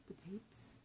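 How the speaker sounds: breathy secret whisper; a barely voiced whisper. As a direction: hush it so only he hears. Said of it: "cleared his throat and whispered".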